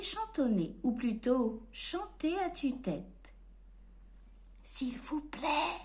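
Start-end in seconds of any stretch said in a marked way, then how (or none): 4.6-5.8 slow timid whisper; shy murmured whisper. Acted. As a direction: barely breathe the words. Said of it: "murmurait Monsieur Silence".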